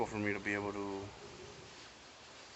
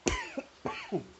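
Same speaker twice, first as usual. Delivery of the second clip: harsh hacking cough; coughing fit. Real, not acted. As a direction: cough hard, out of control. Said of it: "Give me a quick sec".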